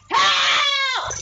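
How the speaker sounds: high, loud cry for help; banging on the wall, shouting for the neighbour. Retold or imitated. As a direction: scream for the neighbour. Calling to neighbour through wall.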